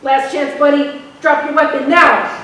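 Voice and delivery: loud, firm command; officer yelling drop it; shouted last warning. Acted. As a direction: bark the last chance. Cop's final shouted command.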